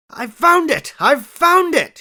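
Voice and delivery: high, loud cry of triumph; bursting with discovery, shouting; excited shout. A narrator's reading. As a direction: shout it out in delight. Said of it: "he shouted to my companion".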